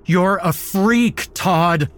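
high, loud furious scream; enraged cry. A narrator's reading. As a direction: cry out in rage at a captor. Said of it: "I cried out in rage".

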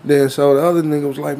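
ordinary delivery

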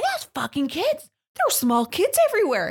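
very high, worked-up protest; retold indignant outburst. Holly back in the car about the kids, indignant outburst. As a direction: imitate an outraged friend, voice shooting up. Retold outraged friend after confrontation.

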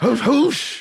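high fake German gibberish; mock German accent. Co-host redoing his German bedroom moans, mock German accent.

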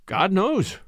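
plain reading voice

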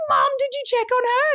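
high-pitched, alarmed question; panicked demand. Retold or imitated. Daughter asking mum about the baby, panicked.

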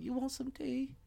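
high, soft put-on English accent; mock posh English accent as Pattinson. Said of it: "with his little English accent".

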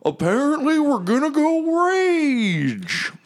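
very high, drawn-out squeaky character voice; rally them in a stretched-out high voice; Lulu. Acted. Flying mammoth calls friends to hop on, high excited holler. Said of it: "And Lulu's like".

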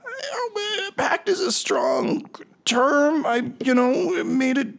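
high, tearful captive protest; hammed-up high whine, stumbling into self-pity; whining excuses. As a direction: plead your case in an acted whimper.

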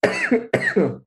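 one last hacking, choking cough; coughing up water. Real, not acted. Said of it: "water went down the wrong pipe".

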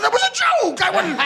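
very high, squealed defence; mock protest. Comic defending himself over the pun, high mock protest. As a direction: plead in a high, comic squawk. Acted protest at getting in trouble.

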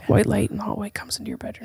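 soft, half-whispered storytelling; hushed and mysterious; hushed scene-setting. Pretend. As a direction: whisper the scene into their imagination.

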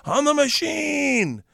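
drawn out, high and slow "I'm the machine"; proud declaration. Retold or imitated. Boasting to Russian gangsters, a slow, high proud claim. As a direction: announce yourself grandly to the gangsters. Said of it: "I'm like".

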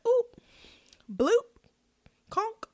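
high, squeaky little pop noises; comic high-pitched oop bloop conk; silly mock pops. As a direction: pop out playful cartoon sounds. Mocking reaction noises to gossip.

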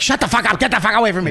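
fed-up, rising lash-out; angry snap. Retold or imitated. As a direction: lash out to push them away. Snapping at whoever bothers you.

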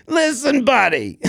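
put-on Boston accent. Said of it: "such a Boston response".

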